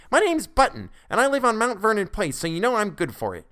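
put-on high, loud boyish protest; angry retort. A narrator's reading. Boy tells the clerk he can pay, loud and high. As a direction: puff up and insist, voice climbing high. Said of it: "he retorted angrily".

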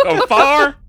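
high Southern voice through laughter; laughing Southern accent. Acted. Player echoing his character's Southern yell while laughing. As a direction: echo it in Southern through giggles. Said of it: "His southern's escaping him".

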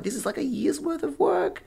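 high-pitched retold panic; freaking out. Artist despairing over a year's lost work, mock freak-out. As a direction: imitate yourself freaking out, pitch way up. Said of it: "full freaking out".

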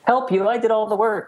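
playfully outraged brother claiming credit; brother Frank protesting. Retold or imitated. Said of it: "You can hear his voice saying".

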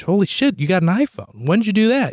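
high, amazed exclamation; reenacted stunned reaction; retold astonishment. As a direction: blurt it out in disbelief. Retelling surprise at mom's iPhone.

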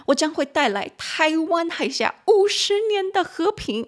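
reading in a mainland Chinese accent, high and grand. Shown as a demonstration. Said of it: "我在念的时候，一直很想用中国的发音呐".

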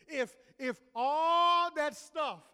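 preacher voicing a desperate believer's doubts; doubting believer. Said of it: "went something like this".

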